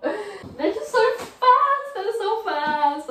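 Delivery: high, drawn-out distressed wail; freaked out. Real, not acted. The mouse darted away too fast, freaked out. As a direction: wail it loudly, still in a panic. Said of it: "Why did I get so freaked out".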